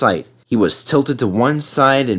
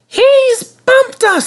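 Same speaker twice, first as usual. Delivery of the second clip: very high, loud, slow squeal; screaming coaches; 5 dB louder, much slower than usual. A narrator's reading. Coaches angry at Sir Handel for bumping them, screaming. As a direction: shriek it like outraged coaches. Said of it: "screamed the coaches".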